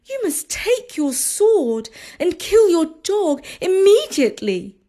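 put-on high urgent young wife; anxious wife. Wife urges killing the dog.

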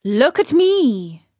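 loud, slow, slightly high fox; fox's appeal. A narrator's reading. Fox warning Pinocchio against study, loud and drawn out. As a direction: demand attention, drawing the words out. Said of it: "said the fox".

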